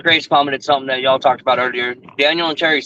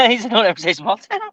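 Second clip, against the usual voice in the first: amused, higher-pitched quote; laughing; 8 semitones higher than usual. Retold or imitated. Friend teasing another about small towns, laughing. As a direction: repeat it through a laugh.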